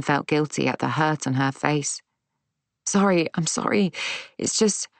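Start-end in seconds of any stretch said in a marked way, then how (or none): 2.8-4.9 higher, tearful apology; upset and faltering. A narrator's reading. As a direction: apologise on the edge of a sob. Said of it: "I let out a sob".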